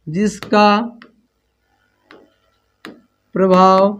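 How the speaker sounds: slow dictation, long pauses between words; teacher reading a definition aloud to be copied. Shown as a demonstration. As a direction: spell it out word by word for the class to write down.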